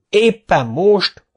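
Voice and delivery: high, exasperated yell. Acted. Foreman yelling at the old man, exasperated. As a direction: throw the question at him. Micu continues.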